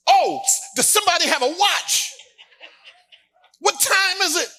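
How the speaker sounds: high, loud panicked question to friends; a kid suddenly scared of being late; panicked boy. Retold or imitated.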